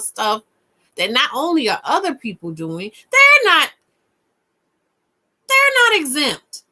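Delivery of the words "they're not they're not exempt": much higher and louder, indignant; high exasperated protest; indignant outburst. Real, not acted. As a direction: burst out in disbelief. Indignant emphasis.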